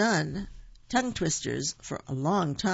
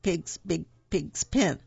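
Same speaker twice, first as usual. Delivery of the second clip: slowed, careful tongue twister; can't go faster; slower than usual. Shown as a demonstration. Host admitting she can't say it faster, slow. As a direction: stumble slowly through the p words. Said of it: "I can't say that any faster".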